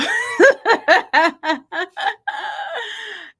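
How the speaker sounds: high, giggly nervous laughter. Real, not acted. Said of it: "That's my nervous laugh".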